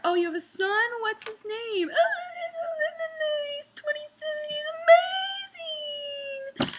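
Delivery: high, gushing, sing-song imitation; mock proud mother. Waitress imitating a diner bragging about her son, high gushing coo. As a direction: gush about your son like a doting mom.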